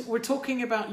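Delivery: ordinary delivery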